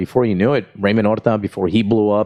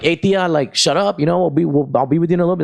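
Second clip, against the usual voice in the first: brushing off heckler; 6 semitones higher, faster than usual. Retold or imitated. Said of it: "And I'm like".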